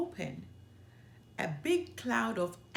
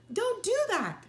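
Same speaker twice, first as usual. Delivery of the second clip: alarmed protest; 12 semitones higher, 5 dB louder than usual. A narrator's reading. Sister protests against the gang.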